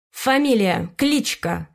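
clipped, irritated teacher; curt teacher demand. A narrator's reading. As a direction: demand the name curtly.

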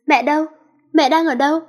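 alarmed calling. Acted. Daughter finds her mother gone from the room, panicked calling. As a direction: call out for mother in rising alarm.

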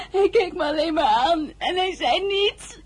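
tearful voice breaking on the words; crying. Acted. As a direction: hold back sobs while you tell it. Heartbroken girl confiding in maid.

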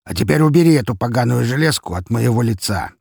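annoyed order in character; irritated demand. A narrator's reading. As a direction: demand the threat be taken away. Boss orders the blade removed.

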